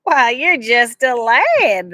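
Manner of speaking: slow, amused stranger; drawing out the surprise. A narrator's reading. Stranger finding Jack is a boy, slow and amused. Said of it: "the man said".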